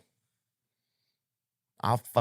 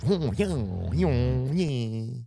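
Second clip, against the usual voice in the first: mock nasal voice; 5 semitones higher, much slower than usual. Continues the nasal mimicry.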